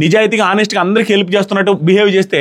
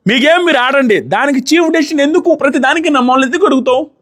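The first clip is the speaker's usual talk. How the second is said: much higher mock yell imitating her; mock shout; 8 semitones higher than usual. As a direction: push the pitch up and shout her words. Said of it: "గట్టిగా అరిచి".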